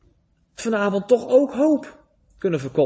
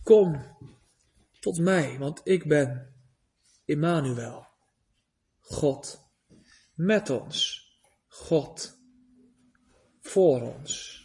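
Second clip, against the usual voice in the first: tender call; 6 semitones lower, 3 dB quieter, slower than usual. Acted. God's tender call voiced.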